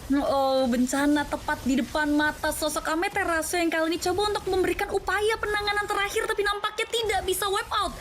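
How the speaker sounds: high-pitched, climactic game-ending call; fighting game announcer. Acted. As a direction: ride the hype to the final push. Live play-by-play game end.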